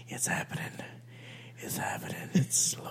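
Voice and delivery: creepy, breathy villain whisper; conniving whisper. Pretend. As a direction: whisper like a schemer about to win. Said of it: "conniving, close to victory voice".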